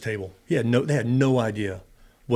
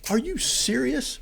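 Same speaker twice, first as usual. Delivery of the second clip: much higher, slow disbelief; incredulous; 14 semitones higher, slower than usual. Retold or imitated. Watching Geraldo draw the map, incredulous. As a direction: let it climb up in disbelief. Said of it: "I'm like".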